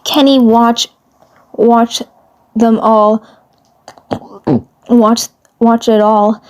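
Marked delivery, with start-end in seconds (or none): none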